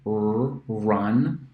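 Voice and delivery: ordinary talk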